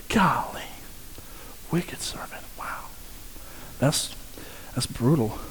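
hushed whisper to himself; stunned under-the-breath whisper; awed whisper. Real, not acted. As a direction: breathe it out in disbelief. Awed aside after the verse.

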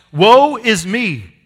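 slow, loud cry of woe; shouting. Retold or imitated. Preacher voicing Isaiah shouting before God, slow loud cry. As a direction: belt it out slowly, stretching every word. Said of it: "He shouts".

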